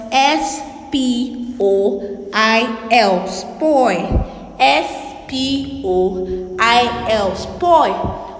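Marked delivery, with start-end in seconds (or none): 0.1-3.3 S P O I L spelled slowly, each letter apart; teacher spelling for young pupils. Shown as a demonstration. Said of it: "And spoil is spelled". 4.6-7.4 S P O I L spelled slowly, each letter apart; teacher spelling for young pupils. Shown as a demonstration. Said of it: "And spoil is spelled".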